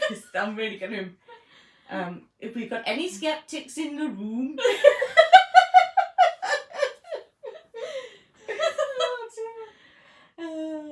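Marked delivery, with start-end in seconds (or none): 2.4-4.6 lightly mock Welsh accent; a hint of Welsh guide voice. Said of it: "Welsh accent". 4.6-10.9 high burst of laughter; laughing hard. Real, not acted. Laughing at her own accent.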